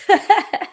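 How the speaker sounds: a quick high giggle; short bright giggle at herself. Real, not acted. Laughs at own lost voice.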